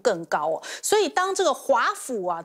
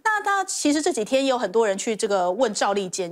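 ordinary delivery

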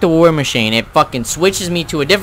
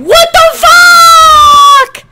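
very high, loud, slow drawn-out shout; raging scream; 10 dB louder, much slower than usual. Real, not acted. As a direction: scream in disbelief at the game. Game rage scream.

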